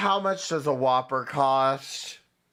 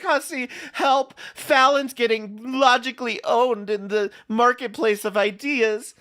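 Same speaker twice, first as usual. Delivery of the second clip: higher, whiny mock plea for help; mocking Danielle; 7 semitones higher, 3 dB louder than usual. Streamer mocks a rival running to Hussy, whiny pitched-up mimicry. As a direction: lampoon a panicked call for rescue. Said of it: "Danielle is gonna go run to Hussy and be like".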